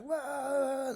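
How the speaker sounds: high, loud startling whoop; wild monster yell. Retold or imitated. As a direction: jump out like the Tasmanian devil. Demoniacs scaring mourners.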